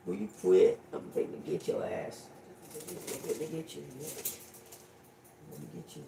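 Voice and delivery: quiet teasing warning; hushed playful threat. Real, not acted. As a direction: murmur a mock threat under your breath.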